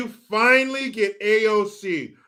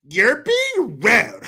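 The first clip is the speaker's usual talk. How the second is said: slow mock scold; 4 semitones higher, 5 dB louder, much slower than usual. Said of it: "That's what she said".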